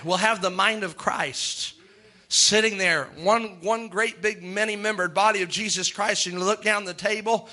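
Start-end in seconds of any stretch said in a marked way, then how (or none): none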